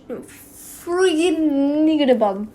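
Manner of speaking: loud, slow, exasperated; real frustration at a word she can't say; frustrated outburst. Real, not acted. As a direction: blurt it out in frustration. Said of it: "I can't say words".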